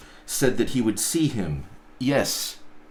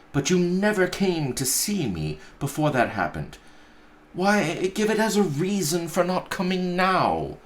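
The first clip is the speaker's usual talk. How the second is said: put-on higher, earnest young nephew; nephew pleading; 9 semitones higher than usual. Nephew begs his uncle to explain, higher and earnest. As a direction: plead gently.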